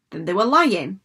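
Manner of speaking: firm, mildly fierce accusation. A narrator's reading. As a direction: accuse them with some force. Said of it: "said Cass fiercely".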